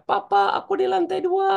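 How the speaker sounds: put-on child's high shout to his father; child calling out. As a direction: call out as a lost child. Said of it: "Yang satu tadi teriak".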